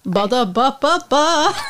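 put-on slow jingle in a Russian accent; mock-Russian jingle voice. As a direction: chant it like a Russian ad tag. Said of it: "I really only know how to do how to Russian accent".

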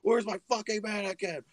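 high, strained mock yell at no extra volume; mock screaming. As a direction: imitate a screaming tantrum, holding back the volume. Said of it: "he starts screaming at her".

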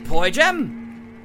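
Poi Jem called out loud. Acted. Activating the police box.

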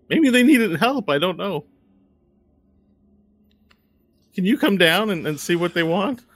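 high-pitched worried messenger; flustered Walnut. Acted. Asking the summoner for help with visitors, high and flustered. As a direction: report trouble in a high, flustered voice.

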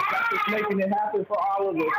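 usual speaking voice

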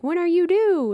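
high, sing-out friendly question; imitated store worker. Worker to the pregnant mom's sister-in-law, high customer-service voice. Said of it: "was like".

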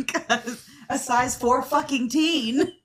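slow words through laughter; laughing. Real, not acted. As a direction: laugh while repeating it. Laughing at size fourteen joke.

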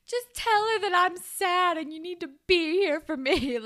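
high, loud, hammed-up pleading; mock needy plea. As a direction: plead like a sad, needy friend, playfully. Retelling a sad friend's plea.

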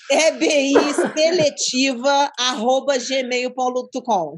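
E B I seletiva arroba gmail ponto read out slowly; slow dictation. Shown as a demonstration. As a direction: dictate each word of the email slowly. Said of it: "um e-mail pra vocês".

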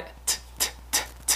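breathy puffed T sounds; aspirated T. Shown as a demonstration. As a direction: puff air hard on each T. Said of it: "gần như biến thành âm thật T H". (âm T bật hơi)

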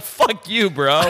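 high mock-angry comeback; retold indignant outburst; defiant retort. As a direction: throw it back, affronted.

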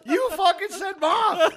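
high, loud laughing words; cracking up while talking. Real, not acted. Laughing while quoting friend.

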